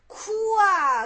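loud, high, drawn-out mock cry; mock wail. Retelling the monk crying at 石霜, mock wail. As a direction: put on a storyteller's sudden wail. Story of monk weeping to master.

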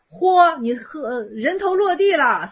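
slow, weighty pronouncement; master's slow verdict. Acted. Master to the shouting monk, slow and grave. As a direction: let each word fall like a blade. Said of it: "然后师说".

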